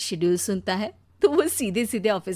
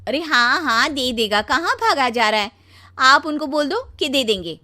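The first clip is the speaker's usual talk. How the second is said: louder, impatient wife; irritated brush-off; 4 semitones higher, 7 dB louder than usual. Acted. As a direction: wave off his worry with raised voice. पत्नी झल्लाकर टालती है. (झल्लाकर टालती पत्नी)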